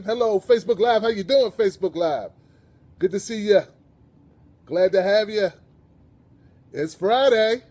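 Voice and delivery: loud, high-pitched show-opening hello; calling out greeting. Real, not acted. Host's customary greeting to live viewers.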